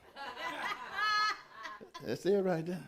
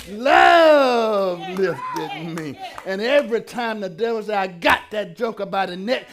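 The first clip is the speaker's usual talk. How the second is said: preaching at full force; 5 semitones higher, 10 dB louder than usual. Acted. Preacher whipping the congregation into a frenzy, shouting and stretching words. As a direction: whoop and stretch each love lifted me like a climax.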